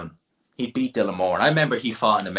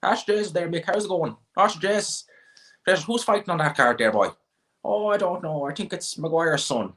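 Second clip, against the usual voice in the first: put-on high-pitched old Midlands Irish banter; Midlands Irish accent; 8 semitones higher than usual. Shown as a demonstration. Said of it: "us do an old Midlands Irish accent".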